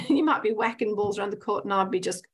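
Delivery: ordinary delivery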